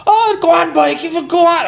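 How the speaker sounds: high mock shout of drunk lads. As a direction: yell it like a rowdy pub crowd. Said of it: "The boys were shouting at me".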